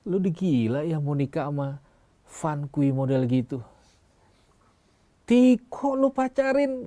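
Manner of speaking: imitated relative, climbing very high at the end; scolding in-law. Wife's relatives scold her over her fiance, mimicked, ending high and drawn out. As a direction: scold in mimicry, last phrase high and stretched. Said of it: "Lalu istri saya dipanggil".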